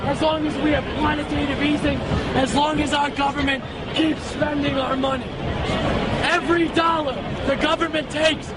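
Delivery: normal talking voice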